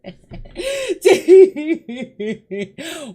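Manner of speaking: high, loud giggling fit; long burst of loud, high-pitched laughter; real laughter. Real, not acted. Host laughing over the crazy stories, real laughter.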